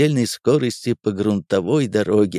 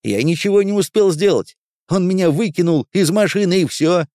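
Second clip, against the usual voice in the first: higher-pitched complaint from a soldier; agitated protest; 7 semitones higher, 3 dB louder than usual. Acted. Soldier tells his officer he was thrown from the car, agitated. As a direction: plead your excuse with more pitch. Driver protests about hijacked jeep.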